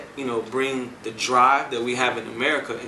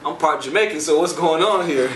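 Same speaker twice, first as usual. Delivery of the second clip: amused surprise; 3 semitones higher, 4 dB louder than usual. Retold or imitated. Telling the reggae artist he is part Jamaican, louder and amused. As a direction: lean in, half laughing.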